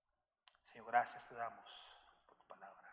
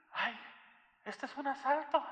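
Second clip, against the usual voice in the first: frightened shout, much higher and louder; startled cry; 11 semitones higher, 9 dB louder than usual. Retold or imitated. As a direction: yell it like you've walked into a robbery. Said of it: "y se asusta y dice". (grito asustado, mucho más agudo y fuerte)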